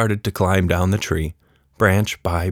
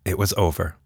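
ordinary delivery

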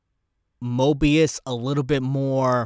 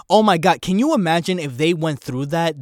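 excited outburst; 4 semitones higher, 6 dB louder than usual. Real, not acted. Excited by new theory.

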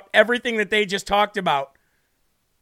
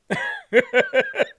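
high laugh. Real, not acted. Laughing at own joke.

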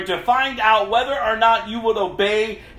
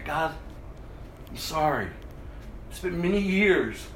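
normal talking voice

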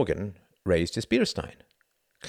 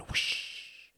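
soft, voiceless gliding whoosh; hissed whoosh; 11 dB quieter than usual. A narrator's reading. Pilot miming a glider behind enemy lines, hissed whoosh. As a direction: imitate a silent glider with a soft hiss. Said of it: "he hissed".

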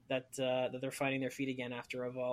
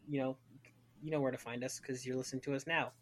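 usual speaking voice

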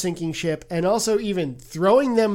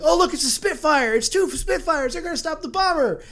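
excited son; 9 semitones higher than usual. Retold or imitated. Son spotting Spitfires.

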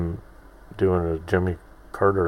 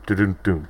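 spoken drum sting after a joke; mouthed rim shot; faster than usual. Pretend. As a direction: imitate a comedy rim shot with your mouth. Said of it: "the rim shot".

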